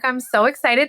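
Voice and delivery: ordinary delivery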